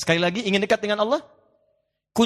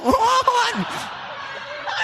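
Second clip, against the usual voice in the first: laughing shout. Real, not acted. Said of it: "ini repot".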